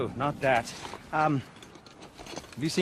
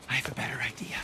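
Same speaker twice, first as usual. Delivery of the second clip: soft, breathy conspiratorial whisper; 8 dB quieter, faster than usual. Acted. Smith whispering a sneaky plan to the warrior, hushed whisper.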